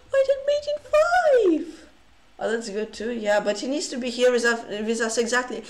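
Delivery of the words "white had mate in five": high, loud astonished gasp; rising into a high, stunned squeal; shocked disbelief. Real, not acted. Streamer spotting a missed mate in five, shocked.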